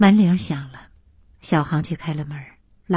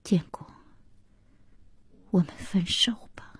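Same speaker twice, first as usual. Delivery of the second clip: hushed, breath-heavy and soft; quietly breaking it off; low voice; 8 dB quieter than usual. A narrator's reading. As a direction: say the breakup line under your breath. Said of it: "低声说".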